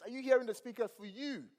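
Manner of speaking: ordinary delivery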